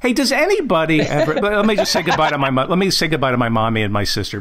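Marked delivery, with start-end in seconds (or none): none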